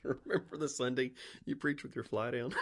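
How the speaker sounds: squealing with laughter; laughing. Real, not acted. Friends cracking up over the preacher's fly, laughing hard. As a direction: laugh through the words, high and loud.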